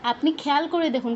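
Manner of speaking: ordinary talk, nothing marked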